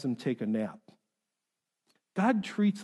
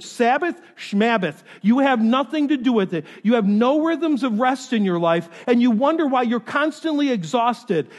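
loud, raised-pitch exhortation; forceful preaching; 6 semitones higher, 7 dB louder than usual. Real, not acted. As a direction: preach hard at the congregation, driving each point.

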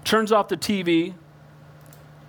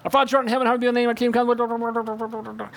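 rote recital; faster than usual. Retold or imitated.

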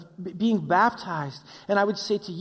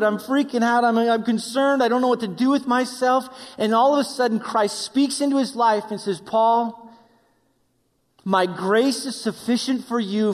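louder, pleading pulpit voice; fervent preaching; 4 semitones higher, 6 dB louder than usual. Acted. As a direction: preach the plea with rising urgency. Voicing Paul and Christ.

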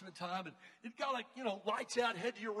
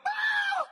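loud, high nightmare scream; startled scream; 13 dB louder than usual. Retold or imitated. Speaker acting out waking from a choking dream, screaming. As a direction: scream as if jolted from sleep. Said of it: "you ever woke up screaming".

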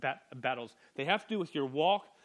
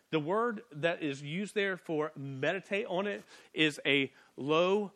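ordinary talk, nothing marked

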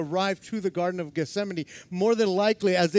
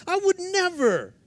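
mock boast; 10 semitones higher than usual. Peter's boast, mocked.

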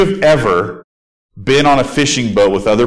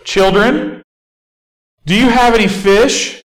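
stranger calling from shore; 9 semitones higher than usual. Retold or imitated. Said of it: "some dude on the beach going".

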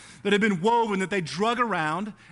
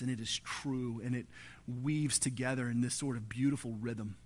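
prayer; 5 semitones lower than usual. Acted. Pastor leads congregation in prayer.

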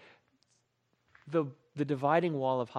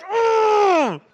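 much higher, loud furious growl; hammed-up angry grunt; 11 dB louder than usual. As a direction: let out a strangled road-rage hum. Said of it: "I get so angry".

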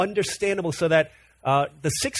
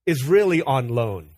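quoted saying; 3 dB louder, slower than usual. Retold or imitated. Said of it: "Here's how Rick Warren says".

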